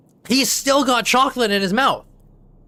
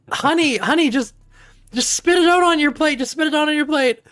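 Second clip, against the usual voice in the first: higher, coaxing mom voice; gently urging her to spit it out; soothing a crying kid at the table; coaxing parent; 7 semitones higher than usual. Retold or imitated.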